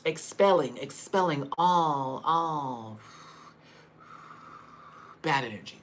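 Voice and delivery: guided breathing. Shown as a demonstration. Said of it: "let's start with our deep breath first".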